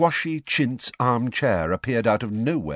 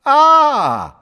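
smirking; 12 dB louder than usual. A narrator's reading. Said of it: "smirking unpleasantly".

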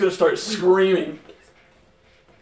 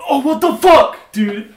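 shocked outcry; 9 semitones higher, 11 dB louder, slower than usual. Real, not acted. Dice roll shock.

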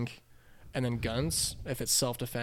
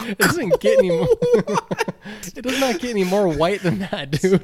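real laughter through loud, high words; laughing hard; 8 semitones higher, 11 dB louder than usual. Real, not acted. Friend cracking up over the president's hobbies, laughing hard. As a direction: crack up while insisting on the point. Cracking up at his own story.

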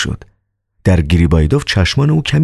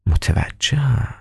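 knowing; 7 semitones higher, 6 dB quieter, much slower than usual. Acted. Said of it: "دکتر این را گفت".

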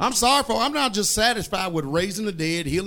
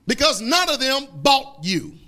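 fervent preaching, building up; 4 semitones higher, 5 dB louder than usual. Acted. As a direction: preach it with full force. Preaching rises in force.

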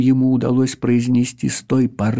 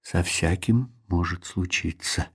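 dejected; 5 semitones lower, 7 dB quieter than usual. Acted. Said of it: "удручённо добавил второй".

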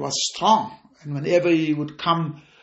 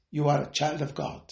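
ordinary delivery

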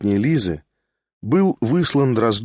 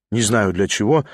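ordinary delivery, nothing marked

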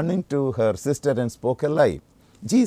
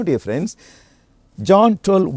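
normal talking voice

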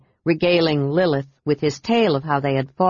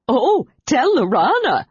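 higher, louder teasing through a held-back giggle; mock the name while stifling a laugh; smothered giggle; 8 semitones higher, 5 dB louder than usual. A narrator's reading. Kender teasing Tanis about Lorana, stifling a giggle. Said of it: "through a smothered giggle".

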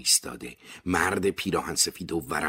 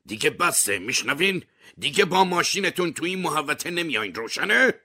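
rising shout, higher each line; 11 semitones higher, 4 dB louder than usual. A narrator's reading. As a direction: yell him off the estate.

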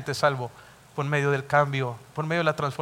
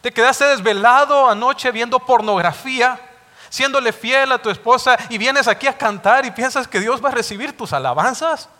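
higher, louder, stern rebuke; God rebuking the unfaithful husband, thundering; God's rebuke; 6 semitones higher, 5 dB louder than usual. Acted. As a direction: scold the hypocrite with fire. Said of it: "lo mismo que le está diciendo".

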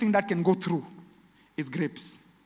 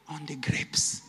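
whispered regret; 5 semitones lower, 5 dB quieter, much slower than usual. Acted. Man's regret, whispered aside.

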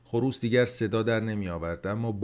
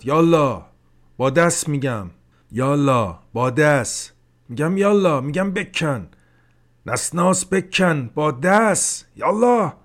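فریاد با غیظ؛ داد خشمگین خیلی بلند و زیر؛ با غیظ امر کن؛ فریاد خشمگین حاجی به بمان; 6 semitones higher, 9 dB louder than usual. A narrator's reading. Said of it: "با غیظ فریاد زد".

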